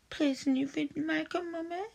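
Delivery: sheepish, mock-shy request; timid plea. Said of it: "why don't you ask really nicely".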